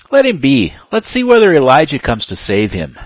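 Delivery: loud, high mocking call; scoffing crowd. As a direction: sneer it out to the others. Bystanders mock and wait.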